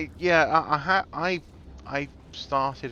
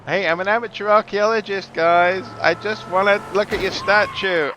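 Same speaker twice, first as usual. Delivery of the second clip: mock friendly shout; 11 semitones higher, 12 dB louder than usual. Calls out to enemies playfully.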